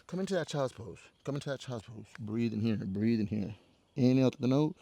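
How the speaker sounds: calming yoga guidance. Real, not acted. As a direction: ease them gently into rest. Leads a resting pose.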